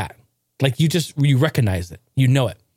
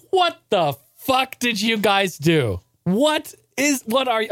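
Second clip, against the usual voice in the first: slow then much higher disbelieving parents; stunned parent, jumping higher in disbelief; shocked parents; 6 semitones higher, slower than usual. Retold or imitated. Parents seeing the old car come home, shocked.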